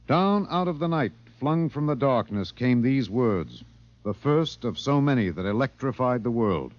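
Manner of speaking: dramatic broadcast narration, slowing on came these words; radio narration. A narrator's reading. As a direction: build the mystery from the dark. Narration introduces the tape.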